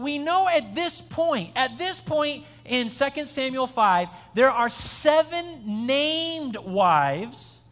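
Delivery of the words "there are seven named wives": slow and deliberate. Real, not acted. Stressing the count slowly.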